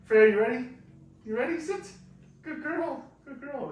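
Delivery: high, loud calls to a dog; firm dog commands. Real, not acted. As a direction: call the dog, then praise her high. Trainer calls and praises dog.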